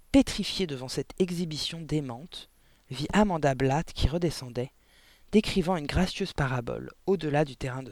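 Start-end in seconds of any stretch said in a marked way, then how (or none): none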